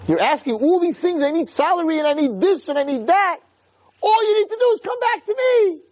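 high-pitched, anguished pleading; crying king. Acted. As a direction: plead at full pitch like a heartbroken father. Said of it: "the king starts to cry".

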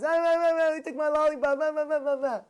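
high, loud mock bawling; hammed-up child wailing for mother; crying kid. Kid cries over a taken lollipop, mock wail. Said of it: "like the kid who cries".

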